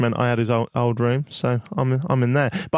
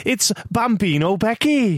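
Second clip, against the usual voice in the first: slower, higher Welsh voice; Welsh accent impression; 6 semitones higher than usual. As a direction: put on her Welsh lilt. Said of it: "I'm gonna do it in your voice".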